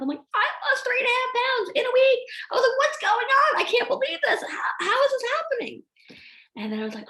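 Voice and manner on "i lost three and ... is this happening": high-pitched amazed exclamation; exaggerated, excited bewilderment; astonished disbelief. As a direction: act out being floored by good news. Acting out astonishment at weight loss.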